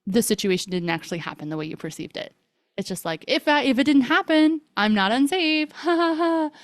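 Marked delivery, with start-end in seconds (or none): 3.3-6.5 high-pitched pretend brightness with forced laugh; mock cheerful denial. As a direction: voice someone talking themselves into feeling fine. Voicing the appeasing mind's denial.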